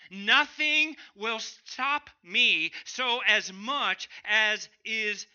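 slow, loud, drawn-out determination; loud slow vow of resolve; intense resolve. Shown as a demonstration. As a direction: grind it out with slow force. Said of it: "we might say it this way".

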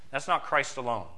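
ordinary talk

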